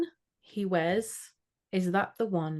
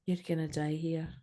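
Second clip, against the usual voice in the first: put-on best Scottish accent; 7 dB quieter than usual. Host redoing the witch's warning, in a soft Scottish accent. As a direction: say it softly in your best Scottish. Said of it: "doing that in my best Scottish accent".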